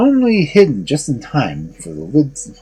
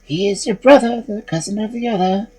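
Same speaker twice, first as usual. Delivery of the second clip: small lamb voice; 9 semitones higher than usual. A narrator's reading. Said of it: "the lamb's flesh answered".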